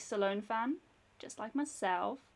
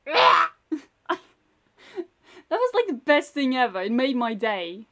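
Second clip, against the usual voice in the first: excited; 5 dB louder than usual. Real, not acted. Recalling funny cat video.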